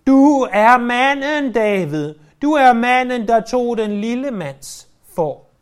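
ringing accusation. Acted. Preacher repeating Nathan's words to David, loud and accusing. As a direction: hurl the words at him. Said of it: "Sæt dig lige i Davids sko der".

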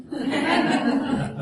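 light high-pitched laugh. Real, not acted. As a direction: laughs at his own joke. Laughs at own joke.

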